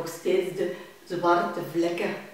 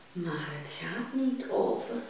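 plain reading voice

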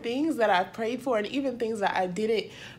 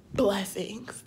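whispered; 4 semitones lower, 7 dB quieter than usual. Real, not acted. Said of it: "I mean, abundant".

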